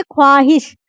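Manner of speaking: ख्वाहिश with the "w" sounded; a clear glide after "kh", "khwaa-hish"; old wrong reading. Shown as a demonstration. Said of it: "अब तक हम लोग इसको पढ़ रहे थे".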